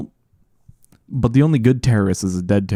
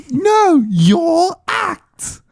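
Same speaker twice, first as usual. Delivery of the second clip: high, loud shouted cartoon voice; cartoon character voice; 4 dB louder, much slower than usual. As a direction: shout it like a wacky cartoon critter. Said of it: "what does he say?".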